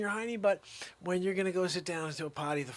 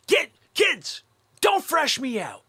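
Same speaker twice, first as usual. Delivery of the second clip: put-on higher, louder shout at the kids; hammed-up frustrated dad yell; mock yell; 7 semitones higher, 9 dB louder, slower than usual. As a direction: bark it at the kids. Yelling at kids.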